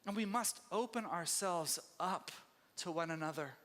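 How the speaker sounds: normal talking voice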